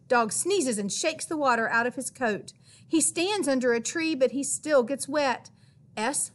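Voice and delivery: plain reading voice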